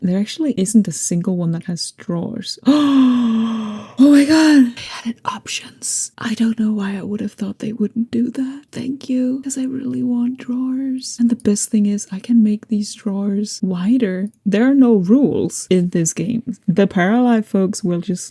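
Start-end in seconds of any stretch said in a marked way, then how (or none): 2.6-4.8 high, loud startled gasp; surprised gasp. Real, not acted. Discovering drawer options. 4.8-14.3 excited breathy whisper. Real, not acted. Whispering in delight.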